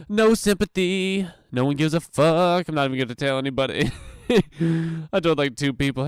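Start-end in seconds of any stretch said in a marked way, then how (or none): 0.1-2.7 loud and high, pushing each word out; mock loud rant. Podcaster ranting at the rejected, a loud mock rant. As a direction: belt it out like a fake rant.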